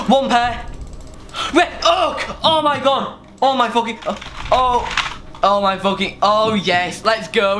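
high, breath-catching win reaction; gasp, then cry out high in stunned joy, settling into triumph; thrilled gamer celebrating; ecstatic disbelief. Real, not acted. Celebrates a sudden big win.

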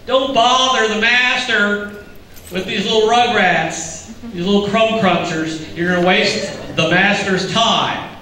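put-on high, slow, scornful voice shooing the kids away; mock the disciples brushing off the children; dismissive disciples. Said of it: "That was their attitude".

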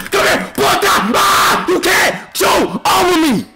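trash talk shout. Real, not acted. Gamer defending opponent's shot, shouting. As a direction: get right in his face.

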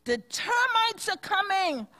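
high alarmed cry of a kangaroo. Acted. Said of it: "cried a large kangaroo".

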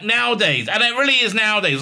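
ordinary delivery

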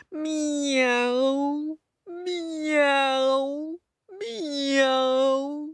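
long, high wailing miaow; very sad meow. Shown as a demonstration. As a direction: mew like a self-pitying cat. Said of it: "Can you do that very sad meow?".

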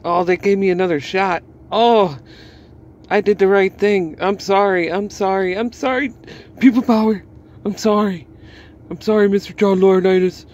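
grovel like a wrestler begging forgiveness; mock groveling. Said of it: "you're gonna be like".

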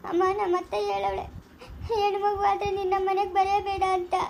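tearful complaint to her mother; crying. Acted. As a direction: sob out the hurt. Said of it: "ಅಕ್ಕ ಅಳ್ತಾನೆ ಅವ್ರೆ ದೊಡಮ್ಮ".

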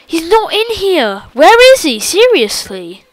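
loud, frantic and high; scared shout of where is he; panicked. Real, not acted. Scared search, yelling.

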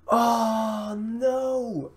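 long, high-pitched lament; anguished wail. Real, not acted. Gamer missing his big chance, anguished. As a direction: draw it out in despair.

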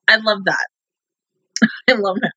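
ordinary talk

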